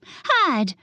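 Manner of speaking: urgent high shout. Acted. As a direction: yell a quick warning. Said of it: "Amelia shouted".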